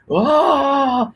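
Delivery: short high comic shriek; high hammed-up cry out; mock scream. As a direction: yelp like a cartoon victim.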